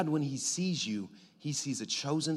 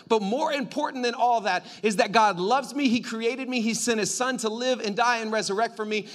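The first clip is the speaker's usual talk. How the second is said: loud, raised preacher climb; preaching at full force; 6 semitones higher, 6 dB louder than usual. Acted. Preacher building to God loves me, loud and rising. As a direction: build it up for the crowd.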